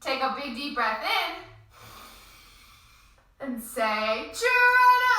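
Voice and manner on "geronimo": muffled, high, loud yell; hand over mouth. Shown as a demonstration. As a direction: yell through cupped hands, far away. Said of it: "Put your hands over your mouth so you sound really far away".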